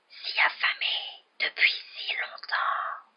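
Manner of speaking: eerie drawn-out whisper; cold, deadly voice. A narrator's reading. Unseen voice in the passage, deadly whispering. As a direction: whisper each word with cold menace. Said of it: "la même voix froide et mortelle".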